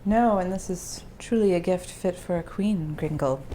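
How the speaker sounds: ordinary delivery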